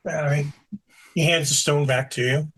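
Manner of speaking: ordinary talk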